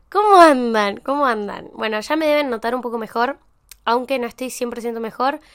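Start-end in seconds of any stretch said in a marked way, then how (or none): 0.1-1.0 loud greeting. Real, not acted. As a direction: call out the hello brightly. Greeting the listeners again. (saludo en voz alta)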